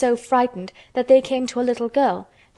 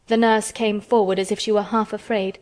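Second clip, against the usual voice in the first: ordinary delivery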